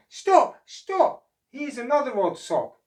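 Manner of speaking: very high, loud alarmed shout. Acted. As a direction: yell to halt everything. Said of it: "the otter shouted out".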